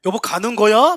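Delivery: high, loud shouted question; calling out. Acted. Spouse calls after the other one, loud high shout.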